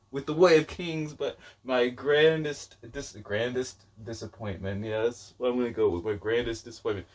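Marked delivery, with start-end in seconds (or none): none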